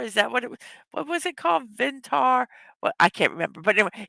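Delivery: usual speaking voice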